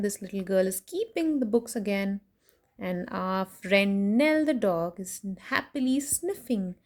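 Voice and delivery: plain reading voice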